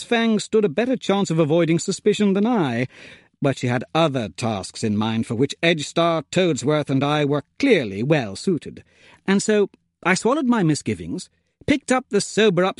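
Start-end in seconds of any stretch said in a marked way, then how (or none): none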